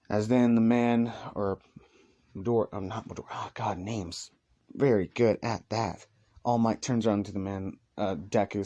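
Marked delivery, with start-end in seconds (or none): none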